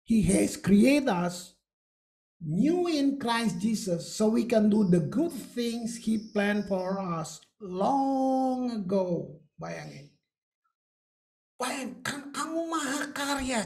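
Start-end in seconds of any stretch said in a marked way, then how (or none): 0.1-9.4 slow, solemn English verse reading; slow deliberate scripture read. Acted. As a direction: read it out like holy text.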